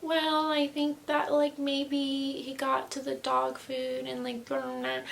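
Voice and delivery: mock mom's tentative explanation, trailing into blah-blah; imitating mom. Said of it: "My mom is very like".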